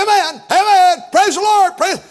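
high, loud repeated amens; eager pew shouter; churchgoer shouting amen. Retold or imitated. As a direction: call out like a fired-up congregant. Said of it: "and someone say".

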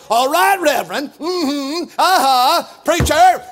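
eager congregation amens; congregation call-back. Retold or imitated. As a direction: cheer on the preacher. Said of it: "They all call you Reverend".

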